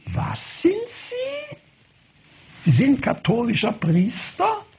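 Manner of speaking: high, drawn-out stunned cry; shocked disbelief. Retold or imitated. Doctor reeling at hearing he is a priest, shocked high cry. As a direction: gasp it out as if struck.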